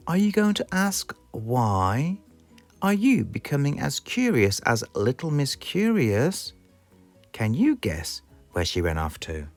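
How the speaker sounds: usual speaking voice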